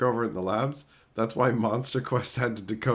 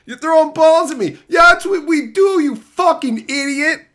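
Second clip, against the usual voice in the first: higher and louder yelling; angry shout; 12 semitones higher, 10 dB louder than usual. Acted. Telling off a whining player with a loud yell. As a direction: yell it at the whiner. Ranting at complainer.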